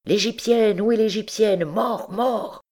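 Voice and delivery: distant crowd shouting. A narrator's reading. Soldats courir avec des cris.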